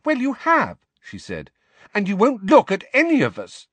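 high, loud accusing retort; indignant retort. A narrator's reading. Ginny accuses Harry of avoiding them, indignant retort. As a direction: throw it back at him.

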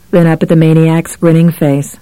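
usual speaking voice

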